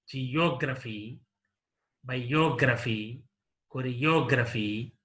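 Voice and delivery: "geography", "biography", "choreography" stressed on the OG syllable; ge-OG-raphy, bi-OG-raphy, chore-OG-raphy, third-last syllable stressed; antepenultimate stress. Shown as a demonstration. Teacher modelling word stress, "geography", "biography", "choreography" stressed on OG. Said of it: "on the antepenultimate syllable".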